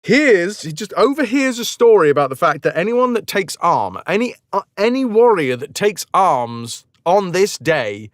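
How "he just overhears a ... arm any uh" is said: ordinary talk, nothing marked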